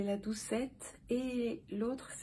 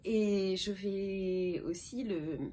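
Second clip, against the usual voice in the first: ordinary talk, nothing marked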